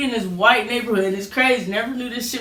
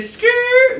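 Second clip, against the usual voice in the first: much higher squealing skid noise; tire screech; 7 dB louder than usual. Retold or imitated. Kid speeding off on the minibike, mimicking a screech. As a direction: imitate tires peeling out. Imitating the bike taking off.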